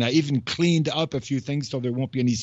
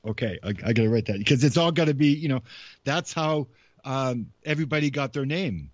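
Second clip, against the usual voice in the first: normal talking voice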